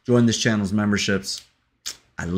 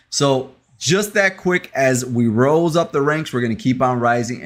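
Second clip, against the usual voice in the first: excited; 5 semitones higher, 6 dB louder than usual. Real, not acted. Celebrating channel milestones to viewers.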